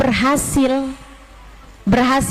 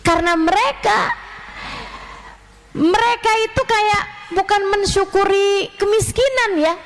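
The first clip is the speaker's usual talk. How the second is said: ordinary talk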